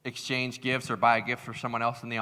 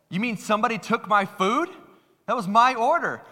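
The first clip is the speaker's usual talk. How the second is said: high, loud mock outrage; indignant customer; 9 semitones higher, 5 dB louder than usual. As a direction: imitate a customer in disbelief. Retelling customer whose food was taken.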